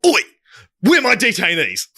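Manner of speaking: high, put-on British guard voice; mock British guard. Quoting a film guard to mates, high put-on accent. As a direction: bark it like a cockney copper. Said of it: "louder and louder and more intense".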